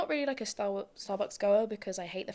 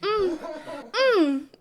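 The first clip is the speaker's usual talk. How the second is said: loud, raised hums of agreement; prayer ad-libbing; 6 semitones higher, 6 dB louder, much slower than usual. Retold or imitated. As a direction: imitate a worshipper chiming in behind a prayer, pitched up. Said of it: "ad-libbing in the background".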